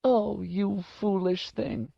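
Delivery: high, slow lament; old lady mourning her pet; grieving old woman. A narrator's reading.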